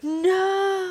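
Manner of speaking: high, drawn-out wail of no; mock protesting "no", stretched out. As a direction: stretch a high-pitched, playful no. Retold refusal to share secret.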